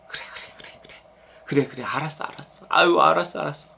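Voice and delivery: very soft, hushed comforting; acted motherly soothing. Preacher voices a mother soothing her upset daughter, hushed. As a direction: murmur patient reassurance, almost whispered at first.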